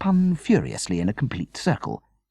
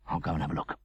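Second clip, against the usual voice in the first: hushed; 3 semitones lower, 7 dB quieter, much faster than usual. A narrator's reading. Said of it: "husband at night, alert".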